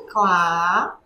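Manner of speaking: CLÁ held long and low; drawn-out syllable. Shown as a demonstration. Teacher writing the next syllable, "clá" slowly drawn out. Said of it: "Próxima sílaba é clá".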